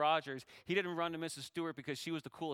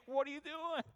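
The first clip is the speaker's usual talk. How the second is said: a high, incredulous question from Jesus; lightly voiced Jesus, puzzled rebuke; Jesus asking; 12 semitones higher than usual. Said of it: "Jesus said".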